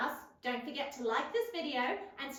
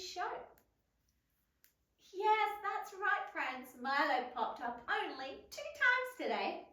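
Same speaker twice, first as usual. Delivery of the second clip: kindergarten teacher voice; 2 semitones lower, 5 dB quieter than usual. Acted. Host addresses children.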